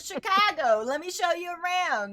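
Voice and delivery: high, animated mock-grand welcome; playful excited welcome. Playful welcome to a fellow Chicago-style eater.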